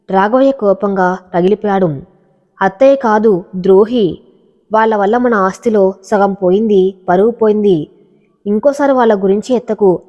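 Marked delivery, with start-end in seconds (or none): none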